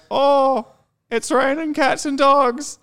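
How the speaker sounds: high, slow, hammed-up corny delivery; mock cartoon husband. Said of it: "they'd be like".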